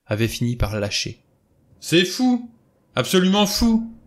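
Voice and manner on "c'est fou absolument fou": high, loud, nearly strident cry; violent outburst. A narrator's reading. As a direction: hurl it out as nerves give way. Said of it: "lança-t-il avec violence".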